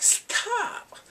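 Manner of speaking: very high, squealed protest; mock exclamation. Viewer reacting to crazy one-liners, high mock squeal. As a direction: squeal it like an overwhelmed fan.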